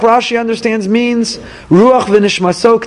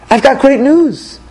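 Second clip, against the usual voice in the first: higher, drawn-out upbeat announcement; excited good news; 5 semitones higher, slower than usual. Acted. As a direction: announce it like a wonderful surprise. Acted friend announcing good news.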